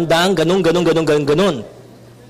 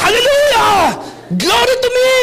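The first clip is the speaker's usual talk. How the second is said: hammed-up self-praise. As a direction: ham up a preacher shouting praise to himself. Mock Jesus praising himself, sermon joke.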